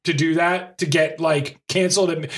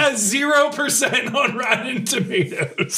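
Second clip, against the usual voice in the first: laughing; 6 semitones higher, slower than usual. Real, not acted. Laughing at bad rating.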